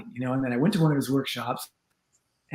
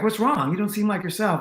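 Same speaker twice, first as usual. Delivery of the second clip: concerned fan; 8 semitones higher than usual. Retold or imitated. Concerned fan asks him.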